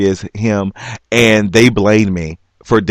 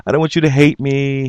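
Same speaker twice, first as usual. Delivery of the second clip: higher, mildly whimpered retelling; retold crying, pitched up; mock tearful plea; 6 semitones higher than usual. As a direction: lightly imitate blubbering through a plea. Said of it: "I start blubbering, you know, and I'm crying".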